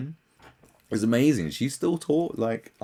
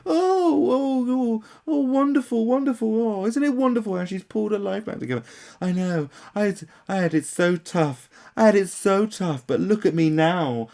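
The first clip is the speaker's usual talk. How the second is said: mock the star's triumphant story; sarcastic gushing parody; 10 semitones higher, 6 dB louder than usual. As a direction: ham up fake pity and pride. Mocking fans, then her.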